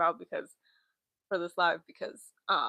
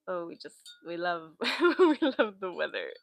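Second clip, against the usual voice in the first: sarcastic laugh; 4 dB louder than usual. Real, not acted. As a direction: fake enthusiasm through a laugh. Sarcastic about weather.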